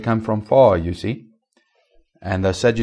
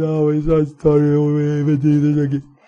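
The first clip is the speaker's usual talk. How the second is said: higher, mock-weepy apology; mock contrite churchgoer; 5 semitones higher, 3 dB louder than usual. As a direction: parody a tearful altar call. Mocking tearful repentance at altar.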